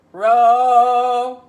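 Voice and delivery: very loud croaked Row in a frog's voice; big frog croak. Acted. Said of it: "croaked the big frog".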